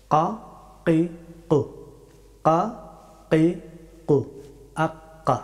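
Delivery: very back of the tongue at the uvula on "কা, কি, কু"; Arabic qaf. Shown as a demonstration. Teacher showing the difficult qaf, far-back k. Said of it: "জিহ্বার একেবারে শেষের অংশ".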